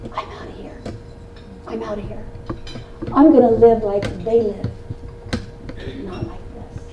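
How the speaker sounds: hushed, breathy whisper, mostly unvoiced; whispered vow. A little girl whispering to the mirror, quiet determined whisper. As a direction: whisper it like a secret promise to yourself. Child vowing to escape, whispered.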